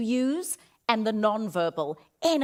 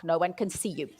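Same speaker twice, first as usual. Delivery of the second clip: ordinary delivery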